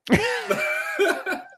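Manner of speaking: a big squealing laugh at the punchline; cracking up high and loud. Real, not acted. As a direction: burst out in a high-pitched laugh. Bursting into laughter at gift.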